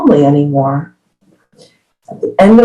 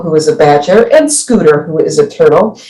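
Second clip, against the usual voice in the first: ordinary delivery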